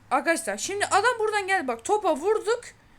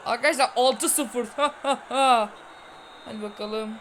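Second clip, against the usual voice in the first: normal talking voice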